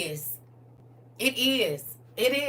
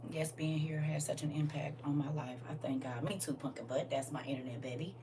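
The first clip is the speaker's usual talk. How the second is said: soft, much lower whisper; 9 semitones lower, 13 dB quieter than usual. Real, not acted. Host whispers back to a viewer's sweet comment. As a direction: murmur it tenderly to the chat.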